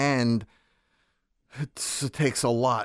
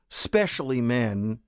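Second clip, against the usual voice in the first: usual speaking voice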